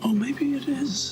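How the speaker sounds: hopeful whisper. Real, not acted.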